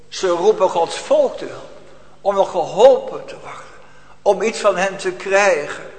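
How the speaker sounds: impassioned preaching, swelling and ebbing; fervent sermon. Acted. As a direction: preach it with urgency. Preaching with rising force. (vurige preek)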